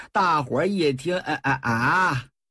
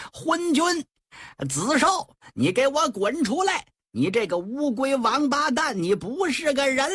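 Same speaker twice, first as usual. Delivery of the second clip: shouted curses; 9 semitones higher than usual. Acted. Generals yelling at the tyrant king through the gate: high shouted curses. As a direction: bellow insults at the gate, pitched high. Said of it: "对着里边就喊呐".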